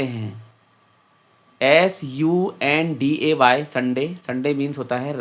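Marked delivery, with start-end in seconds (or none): 1.6-3.6 letters spelled out one by one, slowly; slow spelling. Shown as a demonstration. (धीरे-धीरे अक्षर बोलते हुए)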